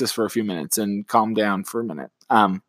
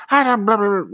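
much higher, mock whine of a stubborn child; whiny kid imitation; 11 semitones higher than usual. Mom imitating her stubborn daughter complaining, mock whine. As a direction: lightly mimic a complaining daughter. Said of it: "she comes up and".

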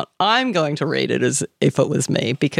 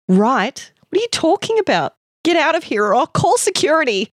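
high, urgent demand; alarmed indignation; 13 semitones higher than usual. A narrator's reading. Amanda ordering the intruder out, alarmed and indignant. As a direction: threaten him with a raised pitch.

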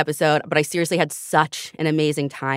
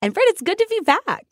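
excited greeting. Real, not acted. Cheerful return greeting.

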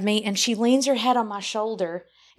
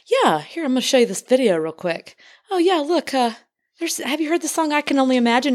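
higher, quick voice imitating an eager friend; lightly acted eagerness, pitched up; eager retelling; 7 semitones higher, faster than usual. Friend offering to show her a video, imitated eagerly.

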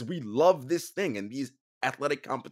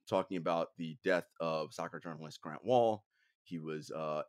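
subdued, lower and softer; somber; 5 semitones lower, 5 dB quieter than usual. Real, not acted. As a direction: break sad news gently, voice dropped. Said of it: "a little bit of a somber note".

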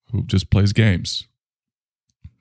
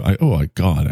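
usual speaking voice

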